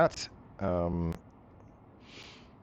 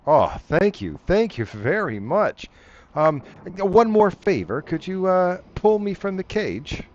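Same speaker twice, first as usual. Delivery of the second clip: higher, louder pleading creature voice; grateful crocodile man; 7 semitones higher, 7 dB louder than usual. Acted. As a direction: beg eagerly, a bit wheedling.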